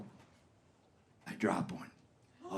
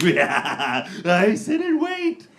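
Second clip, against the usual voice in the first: golem voice; 2 semitones lower, slower than usual. Retold or imitated. The cat turd waiting in the couch, golem voice. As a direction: croak like a lurking golem. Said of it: "hiding down there like a golem".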